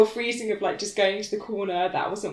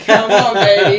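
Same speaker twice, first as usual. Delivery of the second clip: loud, laughing invitation; joking come-on; 2 semitones higher, 15 dB louder than usual. Retold or imitated. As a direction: egg it on through a laugh.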